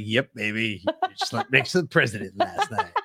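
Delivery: soft, laughing tease; playful low tease to a sleepy partner; soft mock-proud purr; teasing. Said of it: "I was like".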